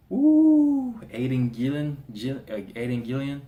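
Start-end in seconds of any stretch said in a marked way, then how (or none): 0.1-1.0 long, loud "woo" held high; amazed high hoot; high whoop. Real, not acted. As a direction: let out a high, drawn-out whoop. Marvels at the actor's reaction.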